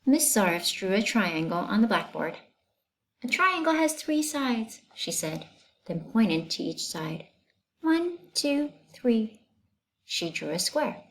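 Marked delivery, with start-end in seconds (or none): 3.2-4.8 high, lilting schoolteacher; teacher voice. Acted. Teacher explaining shapes to her class, high teacher voice. 7.8-9.4 high, lilting schoolteacher; teacher voice. Acted. Teacher explaining shapes to her class, high teacher voice.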